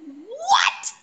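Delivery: high, rising, drawn-out what; exaggerated incredulous question; retold shock. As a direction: reenact stunned disbelief. Retells own shocked reaction.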